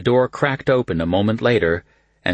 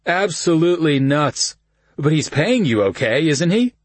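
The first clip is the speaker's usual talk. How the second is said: cousin Kyle voice; 8 semitones higher, 3 dB louder than usual. A narrator's reading. Kyle high, drunk.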